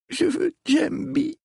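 shaky tearful voice; trembling voice. Acted. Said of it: "d'une voix tremblante".

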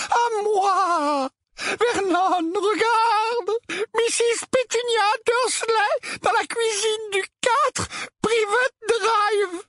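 very high, slow quavering wail; trembling voice. A narrator's reading. Aunt Petunia reading out the address to Vernon, trembling voice. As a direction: draw the words out, high and tearful with fear. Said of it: "d'une voix tremblante".

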